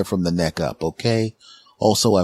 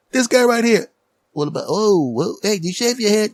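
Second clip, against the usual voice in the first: high, excited shop worker; second clerk voice; 13 semitones higher than usual. Retold or imitated. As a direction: play the coworker called over.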